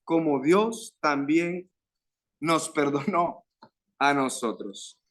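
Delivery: slow, deliberate Bible reading; scripture reading. Acted. Preacher reads a verse to the congregation, slow and solemn. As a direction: draw out each phrase of the verse.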